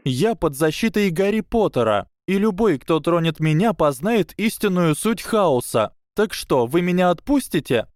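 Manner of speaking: put-on high, thin girl's bluff; meant bold, sounds scared. A narrator's reading. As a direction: try defiance but let fear show. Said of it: "но вышло довольно испуганно". (дерзко, но испуганно, тонким голосом)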